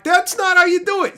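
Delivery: mock correcting. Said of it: "I'm like".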